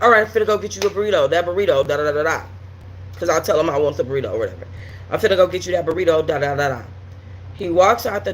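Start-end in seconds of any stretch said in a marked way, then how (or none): 0.0-2.4 fast, offhand mock of his excuse; rushed. Imitating the boyfriend hurrying out for a burrito, rattled off fast. Mimics him rushing out. 5.1-6.8 fast, offhand mock of his excuse; rushed. Imitating the boyfriend hurrying out for a burrito, rattled off fast. Mimics him rushing out.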